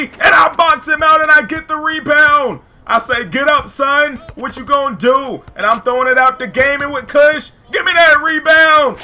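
loud, high play-by-play boasting; hyped up. Real, not acted. Player boxes out the mascot for rebounds, hyped shouting. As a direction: get carried away shouting your own moves. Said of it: "got a little hype".

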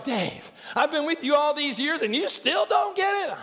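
high-pitched mock exasperation; exasperated God. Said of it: "I know God looks at me like".

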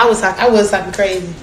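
ordinary delivery

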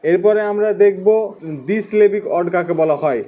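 usual speaking voice

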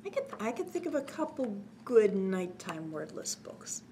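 ordinary delivery, nothing marked

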